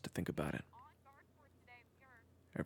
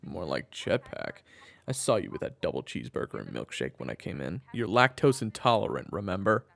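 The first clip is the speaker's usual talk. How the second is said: teasing jab; 10 dB louder than usual. Acted. Husband teasing his wife about her milkshake, louder. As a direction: poke fun at her.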